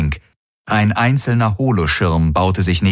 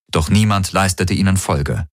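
ordinary delivery, nothing marked

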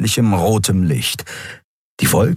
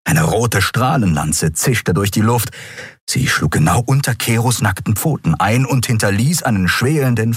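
usual speaking voice

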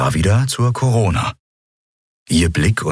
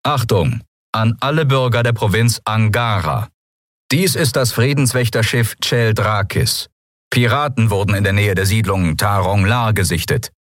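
higher-pitched official warning announcement; disembodied announcement voice; 4 semitones higher than usual. A narrator's reading. Patrol ship warns all citizens of pirates, disembodied announcement. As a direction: address the whole province as an official broadcast. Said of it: "lauschten der körperlosen Stimme".

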